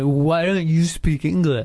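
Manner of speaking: lightly voiced rude drunk customer, a bit slow; mock a rude tourist, only a hint of it; obnoxious customer. Imitating a rude customer to the guest, mild mock scorn. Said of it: "Maybe they're, like, obnoxious".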